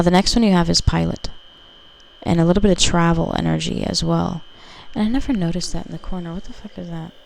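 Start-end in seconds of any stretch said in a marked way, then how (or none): 6.3-7.1 much softer, under the breath; puzzled aside to herself; muttered surprise. Real, not acted. Spots something odd on card.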